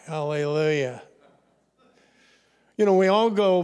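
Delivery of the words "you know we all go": ordinary delivery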